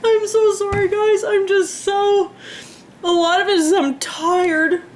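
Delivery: high, tearful breaking voice, on the edge of sobs; crying. Real, not acted. Vlogger apologizing to viewers after giving up her pets, crying. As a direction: cry through the words, voice cracking.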